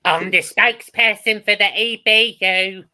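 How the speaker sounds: high, loud, put-on Swedish spokesperson voice; terrible Linda Woodruff impression. Podcaster mocking Eurovision host character, high put-on voice. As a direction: ham up a bad Linda Woodruff. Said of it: "that was terrible impression".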